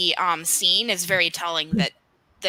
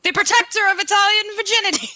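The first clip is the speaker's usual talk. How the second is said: high, loud pompous herald; Chaucer impression; 13 semitones higher, 6 dB louder, faster than usual. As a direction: announce it grandly like a showman. Quoting Chaucer's line from the film.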